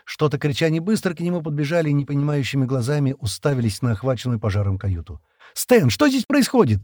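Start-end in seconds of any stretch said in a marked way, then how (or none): 5.5-6.8 high-pitched, alarmed cry; shouted question. A narrator's reading. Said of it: "крикнула Шира". (встревоженный крик)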